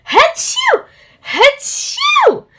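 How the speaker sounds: very high, loud sneezing; big sneezes. Acted. Tickled pig sneezes at Dizzy, with loud sneezes. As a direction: explode into two sneezes. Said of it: "sneezed the pig".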